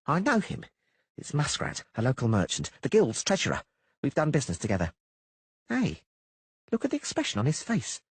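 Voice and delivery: high, brisk halfling voice; halfling Dainty. A narrator's reading. Halfling Dainty brushing off the poet's fears, high and brisk. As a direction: tease the poet in a quick high halfling voice.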